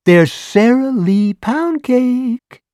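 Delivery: high, slow, coy busybody; high coy flirty voice; flirtatious neighbor. A narrator's reading. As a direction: tempt him with cake, coyly. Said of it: "horrifyingly flirtatious wiggle".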